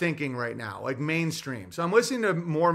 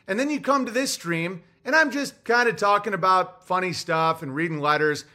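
ordinary delivery

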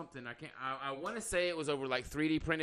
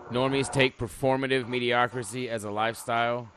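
rereads the post louder, in disbelief; mocking reread; 7 dB louder than usual. Shown as a demonstration. Rereading a stranger's post to cohosts, louder in mocking disbelief. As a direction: say it back as if it makes no sense. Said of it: "What the fuck does that even mean".

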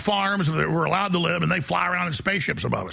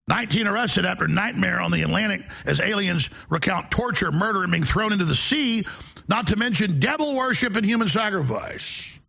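ordinary talk

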